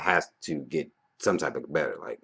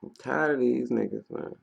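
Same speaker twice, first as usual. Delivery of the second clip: mock fed up; 10 semitones higher than usual. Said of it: "I'm bullshitting".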